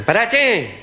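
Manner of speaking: high-pitched yell to stop; shouted call. Acted. Passenger calling to the bus driver, yelling high. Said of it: "siente que le gritan".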